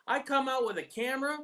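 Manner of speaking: ordinary talk, nothing marked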